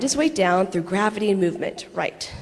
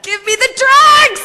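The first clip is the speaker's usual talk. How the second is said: high, loud, slow mock scream; screaming demand; 11 dB louder than usual. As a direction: yell for the drugs through the pain. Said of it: "my body is screaming back".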